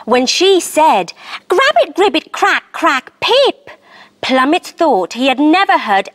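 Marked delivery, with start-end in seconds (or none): 1.5-3.7 Froglish frog chatter. A narrator's reading. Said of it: "She spoke Froglish".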